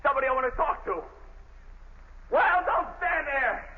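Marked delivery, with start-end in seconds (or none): 2.3-3.7 slow, high, emphatic shout; furious, deliberate order; angry demand. Acted. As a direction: hammer each word at the guard. Prisoner demanding the commissioner.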